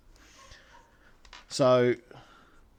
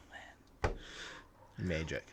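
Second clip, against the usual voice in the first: blissful murmur; 10 semitones lower, 15 dB quieter, much slower than usual. Shown as a demonstration. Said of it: "and it, oh".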